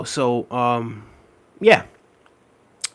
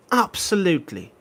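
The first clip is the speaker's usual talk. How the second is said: "absolutely" in a put-on British accent, pitched up; 5 semitones higher than usual. Shown as a demonstration. Streamer to chat doing the British word, mock British accent. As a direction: say "absolutely" like a British football pundit. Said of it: "sometimes I'm gonna say it absolutely".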